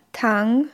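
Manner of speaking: Tang rising from the middle; second tone. Shown as a demonstration. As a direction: let Tang rise like a question. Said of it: "Noch einmal". (Tang im zweiten Ton, steigend)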